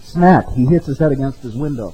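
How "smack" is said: smack sound. Retold or imitated. Head hits the window.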